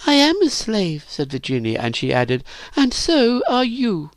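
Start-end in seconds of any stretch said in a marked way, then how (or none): 0.1-1.0 put-on very high girl's voice; defiant admission. A narrator's reading. Virginia answers Phyllis's taunt, defiant. 2.7-4.1 put-on high, slow girl's retort; pointed retort. A narrator's reading. Virginia turns the insult on Phyllis, pointed.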